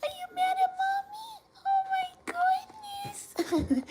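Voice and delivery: very high, soft, slow squeaky coo. Real, not acted. Cooing to her dog Luna.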